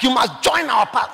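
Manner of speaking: high, loud bullying order; mock threatening demand. Those threatening people pressuring others to join, loud and bullying.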